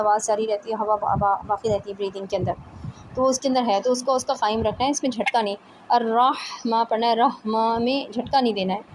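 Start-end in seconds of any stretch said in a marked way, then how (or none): none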